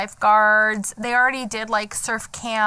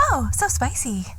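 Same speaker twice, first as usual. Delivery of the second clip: put-on TikTok girl voice. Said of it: "you can see the girls go".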